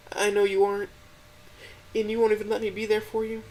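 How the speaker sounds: put-on a crying girlfriend pleading to help; high, gentle, tearful voice; tearful Stella. A narrator's reading. Stella's voice in the story.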